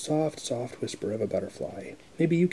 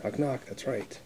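ordinary delivery

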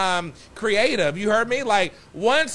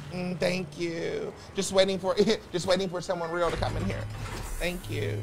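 soft aside; 3 semitones lower, 8 dB quieter than usual. Real, not acted. Sultry aside while waiting.

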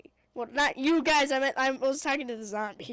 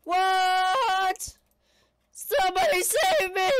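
high, loud cry for help; cracking game-panic yell; panicked yell; 5 semitones higher, 7 dB louder, slower than usual. Real, not acted. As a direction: shout as if overrun. Overrun by zombies.

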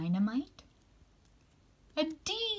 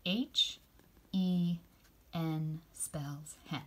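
slow and softer repeat of N spells hen; slow spelling, softer; slower than usual. Shown as a demonstration. Teacher repeating the spelling with the kids, slowly. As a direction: echo the spelling slowly and gently. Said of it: "Can you say that with me".

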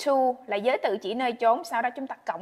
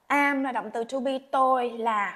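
ordinary delivery

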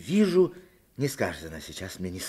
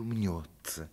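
ordinary delivery

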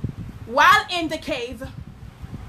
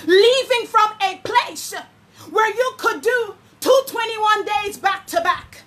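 higher, impassioned preaching cry; preacher near tears, pressing hard; fiery preaching; 6 semitones higher than usual. Acted. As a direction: drive the challenge home. Preaching at full force.

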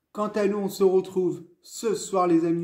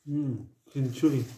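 chewing while talking; muffled by food; mouth full; 3 semitones lower, 3 dB quieter than usual. Real, not acted. Seen 0: Bit onion ring at -3; cheek bulges, chewing through the words.

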